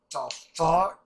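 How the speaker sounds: shocked outburst. Real, not acted. Reacting in shock to episode.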